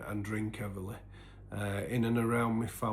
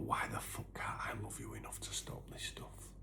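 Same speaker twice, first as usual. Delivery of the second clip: low, softer, unvoiced whisper; whispered despair; 13 semitones lower, 9 dB quieter than usual. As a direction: hiss it to yourself, broken. Despairing inner thought on the stairs.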